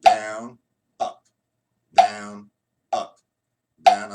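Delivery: exaggerated stroke calls, down loud; slow. Shown as a demonstration. Drum teacher demonstrating strokes slowly for a student. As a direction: call each stroke slowly, "down" hit loud. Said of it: "I'm gonna do it slow".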